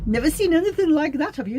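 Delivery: high, fast, hammed-up Scottish voice; a bad, squeaky try at a Glasgow accent; failed Scottish accent. Retrying the Glasgow customer in a botched Scottish accent. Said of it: "No, it's not getting any better".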